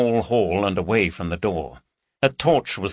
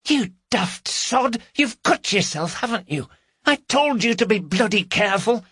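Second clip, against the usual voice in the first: exasperated scolding; 14 semitones higher than usual. Acted. Woman scolds her accomplice who cut himself, high exasperated tone. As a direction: scold him like a fool.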